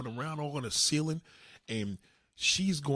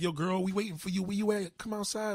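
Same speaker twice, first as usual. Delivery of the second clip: higher-pitched imitation of the girls; girlfriends calling; 6 semitones higher than usual. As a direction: call her out in a raised girly voice. Voicing girlfriends calling her outside.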